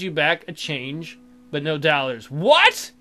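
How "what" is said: high, loud indignant what; mock outrage. Kid shorted at the candy store, in high loud outrage. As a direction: snap it up in disbelief. Cheated of change.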